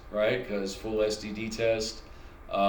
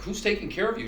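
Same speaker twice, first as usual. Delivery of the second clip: much higher, sing-song nurse voice; nurse's chirpy question; 10 semitones higher, faster than usual. Retold or imitated. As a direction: play the oddly cheerful nurse asking. Nurse keeps asking.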